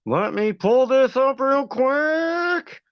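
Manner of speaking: high, slow, stretched-out delivery; singsong stalling. Pretend. Streamer stalls while pulling up recording, playful high drawl. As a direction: draw every word out high, stretching QUICK. Drawn-out mock repeat of own words.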